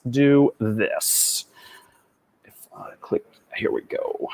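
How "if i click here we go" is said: under his breath, barely voiced; whispering. Real, not acted. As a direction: whisper it to yourself while clicking. Clicks quietly while screen sharing.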